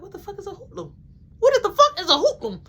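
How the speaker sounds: high, incredulous repeat; baffled by the word hoodlum; confused. Retold or imitated. The teen puzzled by the mom's word, high and baffled. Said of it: "I'm like".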